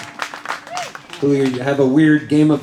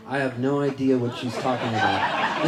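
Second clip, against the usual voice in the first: lower, softer denial; feigned innocence; 5 semitones lower, 7 dB quieter than usual. Acted. Child denying it all to mom, feigned innocence. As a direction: play innocent, low and quiet. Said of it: "I would look at my mom and go".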